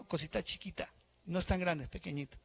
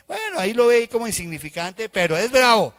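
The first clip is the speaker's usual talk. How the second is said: louder, higher exclaim; mock warning; 5 semitones higher, 9 dB louder than usual. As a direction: warn that the small chili bites. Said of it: "uno dice". (advertencia fuerte y aguda)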